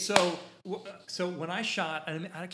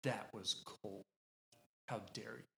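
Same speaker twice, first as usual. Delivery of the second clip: quiet offended father; 3 semitones lower, 9 dB quieter than usual. Retold or imitated. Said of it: "the father ... he was like".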